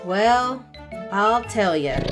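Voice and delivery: ordinary delivery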